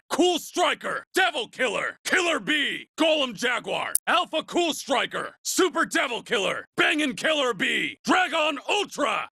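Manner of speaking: high, ringing shouts of attack names; battle cries. Acted. Racer hurling attack names at rivals, shouting. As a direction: yell each move name like a hero calling a finisher.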